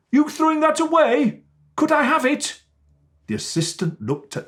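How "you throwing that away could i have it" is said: put-on high, hopeful boy's voice; young boy. A narrator's reading. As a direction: ask like an eager little boy. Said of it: "He said".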